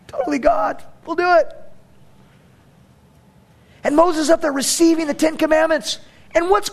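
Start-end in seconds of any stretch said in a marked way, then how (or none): 0.1-1.5 mock eager.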